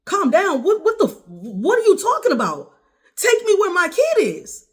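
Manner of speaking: loud, very high-pitched furious yelling; hysterical outrage. Retold or imitated. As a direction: shriek back, outraged at being told to calm down. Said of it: "Kanisha, you gotta calm down".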